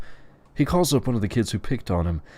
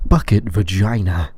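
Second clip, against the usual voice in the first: "bucket vagina" set off and punched louder; emphatic; 7 dB louder than usual. A narrator's reading. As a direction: announce "bucket vagina" with force.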